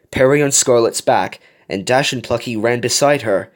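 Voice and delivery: ordinary delivery, nothing marked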